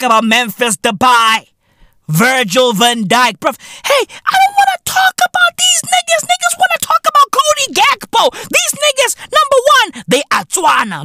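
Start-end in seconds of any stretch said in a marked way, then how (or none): 3.8-10.0 pitched way up, fast; high-pitched mocking rant. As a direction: rattle it off in a high squeal. Ranting voice jumps high.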